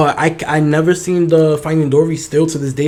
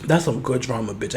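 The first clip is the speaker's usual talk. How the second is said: ordinary delivery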